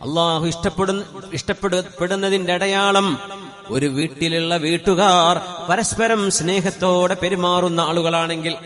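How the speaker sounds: loud, slow, half-sung sermon; preaching. Acted. Preacher telling families which home Allah loves, full-force sermon. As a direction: drive each phrase home, drawn out.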